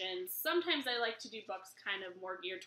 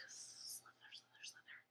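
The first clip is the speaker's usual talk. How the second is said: very soft whisper; snake-like slither; 21 dB quieter than usual. Pretend. Reader asks babies to slither like snakes, whispered slither. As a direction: whisper it with slippery sibilants. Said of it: "Can you slither like a snake?".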